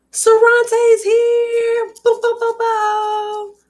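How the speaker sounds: very high, slow sing-song greeting with barks; high goofy bark to greet Sarante; silly sound. Pretend. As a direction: squeal the name and bark like a puppy. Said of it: "I don't know what sound I just made".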